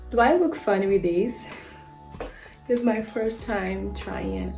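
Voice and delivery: ordinary delivery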